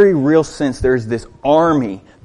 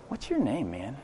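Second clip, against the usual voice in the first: tender question; 15 dB quieter than usual. Acted. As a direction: speak to him as a person, softly. Imagined kind stranger asking.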